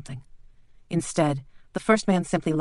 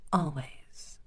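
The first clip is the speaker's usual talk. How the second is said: soft, unvoiced one-word reply; 8 dB quieter than usual. Bleak whispers back to his rival. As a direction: breathe the word out.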